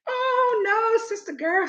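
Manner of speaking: high, drawn-out protest; slow, high-pitched comic objection; retold dismay. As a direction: stretch it out in high mock dismay. Acts out her dismay.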